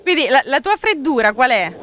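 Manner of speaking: ordinary delivery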